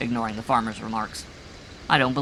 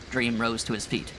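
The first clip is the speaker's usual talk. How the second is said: usual speaking voice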